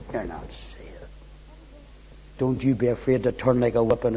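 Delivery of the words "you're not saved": very low and hushed; quiet accusing thought; insinuating inner voice. Acted. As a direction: drop right down to a soft, deep undertone.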